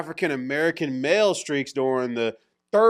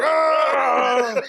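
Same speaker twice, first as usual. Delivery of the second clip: high, loud, long comic scream; mock outraged scream; 10 semitones higher, 6 dB louder than usual. Host mimics conservatives hearing about Green Day, mock outraged scream.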